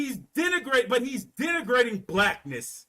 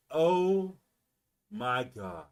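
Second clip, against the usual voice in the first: aghast dismay; 6 semitones lower, much slower than usual. Acted. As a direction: let each part drop out in dismay. Acted dismay in the meeting.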